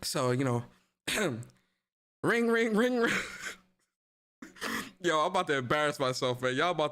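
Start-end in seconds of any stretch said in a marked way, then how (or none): 2.2-3.1 imitated phone ring. Streamer mimics the phone ringing for the CMA bit, high sing-song ring. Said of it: "they call in Phil Spencer".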